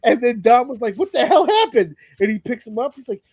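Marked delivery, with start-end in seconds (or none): 1.0-2.0 high, loud startled cry. Retold or imitated. [name] finds [name] electrocuted, cries out high and loud. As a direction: yelp it out in disbelief. Dom shocked in retold segment.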